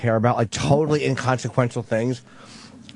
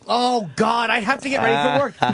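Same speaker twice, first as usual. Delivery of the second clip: high-pitched imitation of a fed-up partner; mock exasperation; 10 semitones higher than usual. Partner rushing off to work, mock exasperation. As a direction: play exasperated, pitched well up. Acting partner dismissing prank.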